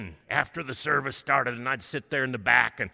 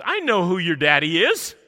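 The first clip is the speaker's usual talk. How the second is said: booming; 6 semitones higher, 11 dB louder than usual. Retold or imitated. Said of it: "big booming voice".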